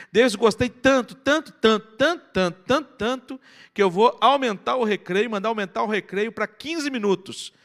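high, loud, emphatic enthusiasm; acted-out delighted reverend; effusive praise. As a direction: pile up the praise, hammering each repeat. Acts out the pleased reverend's praise.

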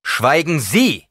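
loud, high, slow order; commanding shout. A narrator's reading. Paul silencing the old woman, commanding shout. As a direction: order her into silence, drawn out.

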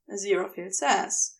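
ordinary delivery

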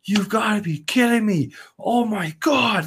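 high, drawn-out amazed cry; stunned disbelief. Retold or imitated. Recalling the save to his friend, amazed disbelief. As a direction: let the disbelief spill out slowly. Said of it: "I was losing it".

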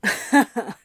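relaxed light chuckle; easy laugh. Acted. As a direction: laugh it off easily. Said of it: "Crowley laughed easily".

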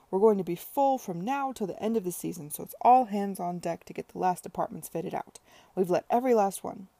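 loud, rushing, jubilant; elated enthusing. Acted. Tom boasting to Charlotte about bookings, loud and elated. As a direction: gush about the full season.

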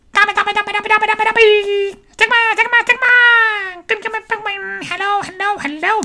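high singsong roll call of viewers; sing-song greeting. Real, not acted. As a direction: chant the names very high, like a playful tune.

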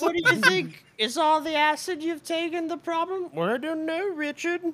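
high, loud squeaky voice; shrill doctor voice, hammed up; playing both parts. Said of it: "He's doing both voices".

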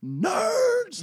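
mocking shout of "Ha! Nerds!"; screamed taunt. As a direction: jeer it at the top of your voice. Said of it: "Like, screamed it".

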